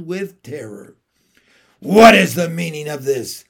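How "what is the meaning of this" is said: commanding, much louder queen's demand; icy queen, demanding an answer; evil queen. A narrator's reading. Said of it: "asked the witch queen".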